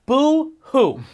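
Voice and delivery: high sarcastic boo-hoo; mock their crying with disdain. Mocking crying players.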